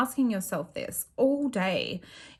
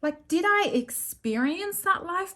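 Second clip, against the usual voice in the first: amazed disbelief; 6 semitones higher, 3 dB louder, slower than usual. Retold or imitated. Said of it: "and I was like".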